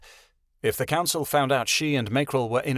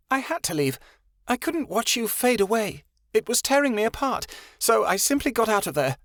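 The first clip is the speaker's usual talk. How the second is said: higher, voice trembling near tears; quivering voice; 10 semitones higher than usual. A narrator's reading. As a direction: hold back tears as you confess. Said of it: "a quiver to her voice".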